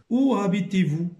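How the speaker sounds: reading example. Shown as a demonstration. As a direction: read the example question out to the class. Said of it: "teacher reads example question". (question d'exemple lue plus fort)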